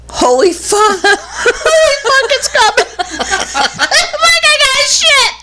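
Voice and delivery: high-pitched mock panic through laughter; laughing panic. Retelling a bathroom emergency in the car, laughing panic. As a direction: squeal the retold panic while cracking up.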